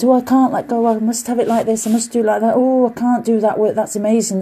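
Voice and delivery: high, fretful imitation of doubters; exaggerated little whine of self-doubt; mock self-doubt. Voicing people who doubt themselves.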